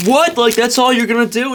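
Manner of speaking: disbelieving what the floor guy offers; mock outrage. She scoffs at the floor man, high mock disbelief.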